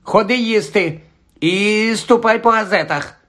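high-pitched call to supper. A narrator's reading. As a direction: call him in with an order. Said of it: "як його кличе тітка Петунія". (гукає високим голосом)

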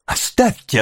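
put-on high-pitched boy's protest; crying out. A narrator's reading. Oliver struggling to break free, crying out. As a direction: cry out high to be let go. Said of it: "крикнул Оливер".

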